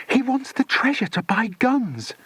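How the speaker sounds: put-on high girl's squeal. A narrator's reading. Dinah alarmed about the guns, high squeal. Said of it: "squealed Dinah".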